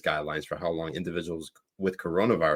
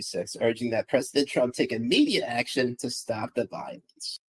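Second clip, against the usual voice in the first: higher-pitched put-on accent for a news read; Jen Rubin accent; 6 semitones higher than usual. Host reads tweet on request, mock pundit accent. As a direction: read the headline in a raised, affected impression voice. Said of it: "do it in your, in your, uh, J-, uh, Jen Rubin accent".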